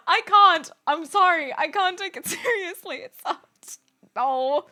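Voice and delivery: high, loud, breaking into laughs; helpless laughter. Real, not acted. Streamer unable to take trend voices seriously, laughing helplessly. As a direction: struggle to talk through the giggles. Cringing, cracking up at imitation.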